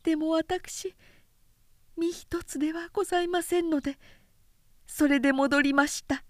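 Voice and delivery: lightly voiced shy young woman; modest reply. A narrator's reading. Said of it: "つつましく答えた". (控えめで高めの妹の声)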